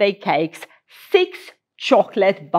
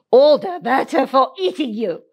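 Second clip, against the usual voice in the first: bellowed; 5 semitones higher than usual. Acted. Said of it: "bellowed the wolf".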